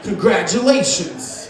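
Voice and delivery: low, slow mock chuckle then congratulations; smug bar guy. Stranger congratulating me on my girlfriend, low and slow. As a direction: chuckle low and hand it over slowly. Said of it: "He goes".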